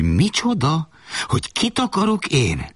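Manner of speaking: high-pitched outraged yelling without much volume; window-rattling yell. Acted. Mother outraged at her son's choice of bride, window-rattling yell. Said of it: "majd ablakrezgetőn ordítva".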